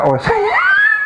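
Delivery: high, squealing burst of laughter; real high-pitched cracking up. Real, not acted. Teased about botched name.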